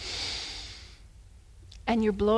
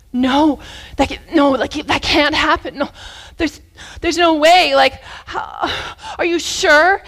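acted rising panic, louder and insistent; anxious boy; 4 semitones higher, 5 dB louder than usual. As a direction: get worked up in anxious disbelief. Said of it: "getting anxious about whatever they're talking about".

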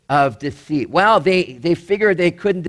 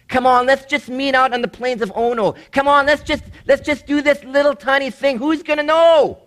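high, sing-out coaxing voice; high-pitched mock enticement; wheedling tempter; 8 semitones higher than usual. Voicing the enemy tempting people.

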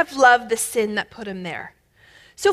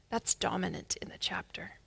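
whisper; 5 semitones lower, 16 dB quieter than usual. Real, not acted. Whispered aside in sermon.

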